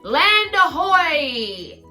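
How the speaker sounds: slow, loud, high call; pirate yell. Acted. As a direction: shout it from the pirate ship. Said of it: "yelled Jack".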